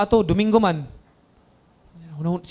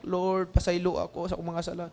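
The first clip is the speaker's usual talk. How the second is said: crying plea; 8 dB quieter than usual. Retold or imitated. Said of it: "sigog hilak".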